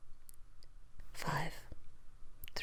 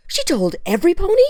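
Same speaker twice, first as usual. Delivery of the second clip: high, loud disbelieving cry; can't believe it, blurt it out; shocked outburst; 13 semitones higher, 7 dB louder than usual. Acted. Butterfly learns everyone knows, shocked loud cry.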